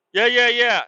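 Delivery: high, loud mock brush-off; dismissive family. Family waving off his invite, a loud high mock brush-off. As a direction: toss it off like you don't care.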